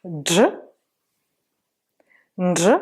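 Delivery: "Dj", then "Ndj" with a nasal onset; "Ndj" as n fused into dj; Malagasy dj demo. Shown as a demonstration. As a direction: sound out "Dj" and "Ndj" singly. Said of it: "On a fini les prononciations".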